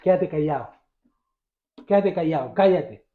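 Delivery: acted hushing, pitched low; low-voiced rebuke. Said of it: "le reprendían".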